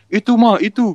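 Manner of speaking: lightly voiced tattling child; annoying kid. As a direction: mimic an annoying kid pointing someone out. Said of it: "anak-anak ngeselin".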